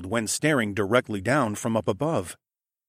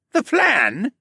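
high, loud scoffing question; mock the question; scornful echo; 12 semitones higher, 6 dB louder than usual. A narrator's reading. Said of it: "like I just asked him the days of the week".

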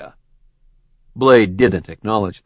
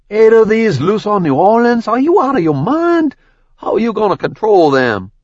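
much higher, louder panicked disbelief; aghast; 12 semitones higher, 5 dB louder than usual. Acted. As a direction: yelp it out, horrified. Nick aghast at Ash.

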